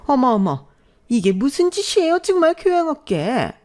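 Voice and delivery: high, indignant mother-in-law shriek; shrill mother-in-law. Acted.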